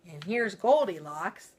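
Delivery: usual speaking voice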